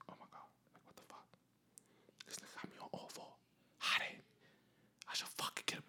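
frantic whisper while hiding under the bed; panicked whisper. Retold or imitated. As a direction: breathe the words out, no voice, scared stiff. Panicked thoughts hiding behind bed.

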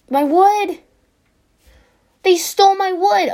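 high, loud cry of protest; outraged yell. Real, not acted. Gamer finds the wood stolen, high outraged yell. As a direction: wail over the theft.

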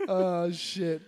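ordinary talk, nothing marked

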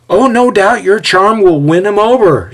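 high, gushing mock praise; sarcastic. A narrator's reading. Xander mocking Ezio's charm, sarcastic. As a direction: flatter him insincerely.

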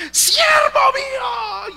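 piercing high, stretched-out shout; imitated woman's scream. Preacher imitates a young woman shouting prophecy at him, screaming. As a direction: wail it out at full force. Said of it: "y a los gritos".

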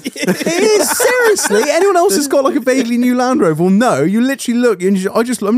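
high-pitched, animated protest over laughter; emphatic insistence. Real, not acted. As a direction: insist excitedly, pitch jumping up then easing down.

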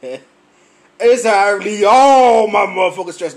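loud drawn-out outburst; mock venting shout. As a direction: let it out in a long, loud mock holler. Jokingly vents about stress.

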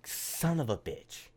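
slow, drawn-out annoyed groan; groaning disappointment. Retold or imitated. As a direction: drag it out, fed up. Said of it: "wake up the next morning like".

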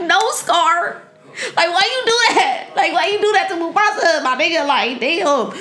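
high-pitched, hammed-up pleading; mock emotional outburst. Viewer pleading with Scar over Mufasa, mock emotional. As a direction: wail in playful protest, laughing through it. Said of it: "I'm finna be emotional".

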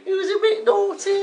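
high, put-on comic mum voice; Anne. Mother phoning the show about Dave, high falsetto. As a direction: play the caller's mum in falsetto. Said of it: "It's Dave's mum".

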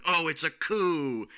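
high, drawn-out mock exclamation; mock alarm. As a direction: exaggerate the big plot twist, loud and slow. Mock voicing of trailer plot twist.

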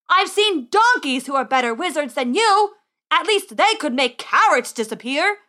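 burly man yelling. Acted. Man mocking failed boy wizard, angry shout. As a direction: hurl the insult from indoors. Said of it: "yelled a burly man".

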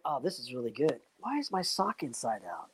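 a quiet, embarrassed murmur to oneself; muttering to himself. Retold or imitated. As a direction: mutter it as if watching yourself alone. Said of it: "I'm like".

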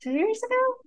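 sudden high, unsure question; surprised doubt. Real, not acted. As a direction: catch yourself doubting the date. Checks herself on the date.

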